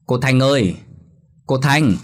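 urgent neighbour calling at the gate. A narrator's reading. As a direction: call her name across the yard at dawn.

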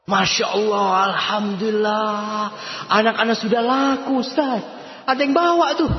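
high, mock-delighted parent voice; mocking proud parent. Preacher mocking parents glad daughter is taken, mock delight. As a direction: lampoon the pleased parent.

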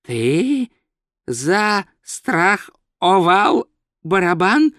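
very slow, word-by-word question; dawning disbelief. Acted. Wizard realises tourist insured the tavern, slow disbelieving question. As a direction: space out each word, incredulous. Wizard slowly grasps what was done.

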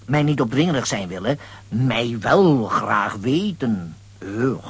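low, slow, lightly put-on broken chief talk; mock Indian speech. Lambiek mimics the chief's broken speech to fit in. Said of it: "Wat spreek je gek?".

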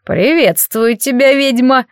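slow, bubbling vodyanoy voice; gurgling water spirit. A narrator's reading. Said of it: "Голос Ромочки был как булькающая в канализации водица".

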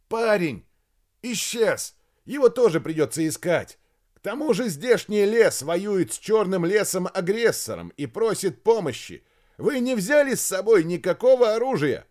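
loud, high, urgent protest; shouted argument. A narrator's reading. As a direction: shout the reasons insistently. Major argues at a shout. (громко и настойчиво спорить)

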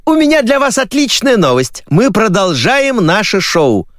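high-pitched TV caller's cry; shouting woman. Acted. TV woman phoning with news of the show, shouting. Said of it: "закричал женский голос".

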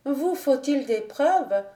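proud lady choking back emotion; holding back tears. Acted. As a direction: keep the tears out of it, slowly. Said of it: "en refoulant par un puissant effort l'émotion".

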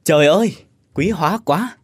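loud, high joyful cry of welcome; exclaiming loudly. Acted. Ông Nhâm greeting his long-lost friend Quang, loud and joyful. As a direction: burst out in delight at an old friend. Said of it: "ông liền nói lớn".

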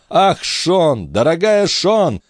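high, plaintive appeal; sobbing plea without real tears; tearful lament. A narrator's reading. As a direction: lament it theatrically to a dear confidante. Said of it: "Жан всхлипнул".

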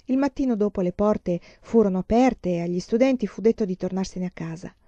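usual speaking voice

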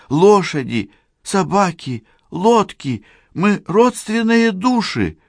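high, pleading female voice; pleading woman. A narrator's reading. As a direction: beg him to remember you. Cheryl pleads with husband.

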